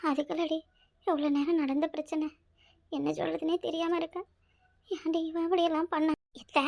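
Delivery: very soft, weary lament; dejected. Acted. Mother-in-law lamenting her son's behaviour, dejected. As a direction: grieve quietly, at a loss for words.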